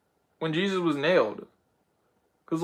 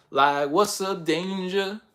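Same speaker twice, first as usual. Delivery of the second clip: drawn out, slow and cool; slow bold question; 3 semitones higher, slower than usual. Retold or imitated. Miles leaping into action, slow and cocky. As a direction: savour each word slowly. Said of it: "Miles just jumped out of nowhere and was like".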